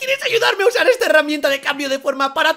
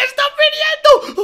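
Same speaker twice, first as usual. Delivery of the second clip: high, loud squeal of alarm; panicked alert; 14 semitones higher, 6 dB louder than usual. Acted. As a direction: sound the alarm in a fluster. Va a ser descubierto. (aviso agudo y alarmado)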